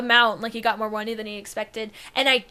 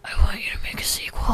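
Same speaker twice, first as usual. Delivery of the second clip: breathy whispered plea. Acted. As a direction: whisper it like a secret.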